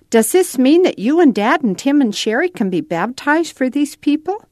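put-on a child asking hopefully, rising; boy Nathan, eager. A narrator's reading.

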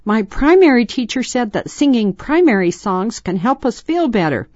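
put-on high, projected child voice. A narrator's reading. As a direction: keep calling over the noise. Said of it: "hoping her brothers could hear her".

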